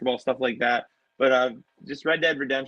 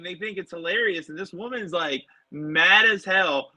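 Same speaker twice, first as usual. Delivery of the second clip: normal talking voice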